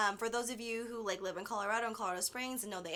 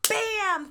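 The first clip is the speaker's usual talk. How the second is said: high loud bam for the impact; loud crash imitation; 10 semitones higher, 8 dB louder than usual. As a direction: shout the crash noise.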